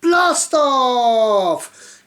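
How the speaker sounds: high, loud cry; shout. Acted. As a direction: yell it as the rocket goes. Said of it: "cried Mister Clever".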